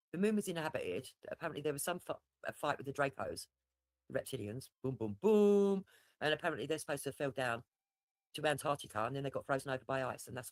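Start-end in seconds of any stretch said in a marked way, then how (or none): 4.8-5.8 slow theatrical boom boom boom; slow booming impact noises. Pretend. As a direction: drum out each boom like an explosion. Mimics fighting impacts.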